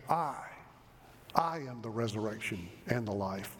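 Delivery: solemn, deliberate stress on "I"; emphatic I. Retold or imitated. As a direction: set "I" apart before "I am the resurrection". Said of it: "Jesus uses that emphatic".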